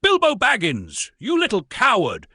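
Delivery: loud, high wizard roar. A narrator's reading. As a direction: roar the insult down at him. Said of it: "he roared".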